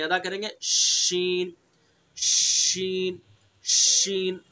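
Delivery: slow "शीन" with the breath spread in the mouth; spread shin. Shown as a demonstration. Teacher demonstrating the letter, slow spread-out demo. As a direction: stretch "शीन" slowly, air spread wide. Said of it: "मुंह के अंदर हवासी को फैला देंगे".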